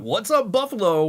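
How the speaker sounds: high, bright crowd greeting; cheery mock frontman; stage shout-out. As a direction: greet the venue by name. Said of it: "that doesn't work for me".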